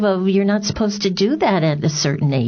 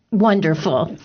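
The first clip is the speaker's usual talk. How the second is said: usual speaking voice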